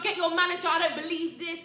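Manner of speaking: high, indignant outburst; imitating an indignant customer; loud demand. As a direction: demand it loudly, in disbelief. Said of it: "he was so loud".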